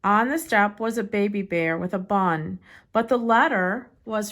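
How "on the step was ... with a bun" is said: ordinary delivery, nothing marked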